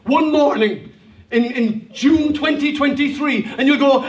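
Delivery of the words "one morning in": normal talking voice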